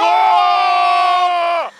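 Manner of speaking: loud delighted cheer; excited shout. Real, not acted. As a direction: cheer loudly at the win. Big hit on the machine. (興奮した叫び)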